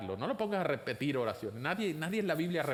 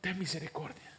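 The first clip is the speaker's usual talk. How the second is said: soft, pleading prayer; a touch of weeping in a quiet plea; mock tearful plea; 5 dB quieter than usual. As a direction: beg softly for mercy, lightly acted. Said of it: "puedes orar llorando y decirle".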